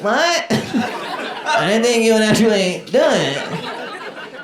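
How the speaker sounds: high startled squeak, then slow; a high, slow, stunned teenage girl; shocked fangirl. Retold or imitated. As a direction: caught off guard that he said yes. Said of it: "She's like".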